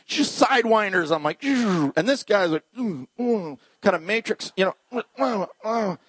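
usual speaking voice

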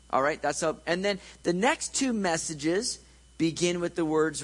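ordinary talk

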